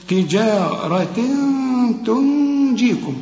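slow "تجارة تنجيكم" with a hummed nasal n; measured recitation, stretched and nasalised joins; recitation with ghunna. Shown as a demonstration. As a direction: hold the nasal hum before ت and ج. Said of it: "هنا محتاجة غنة".

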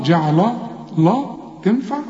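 normal talking voice

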